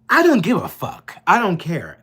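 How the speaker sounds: high and loud, furious defiance; defiant outburst. Real, not acted. Raging about tax dollars overseas, real defiant shout. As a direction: fling it out in real anger.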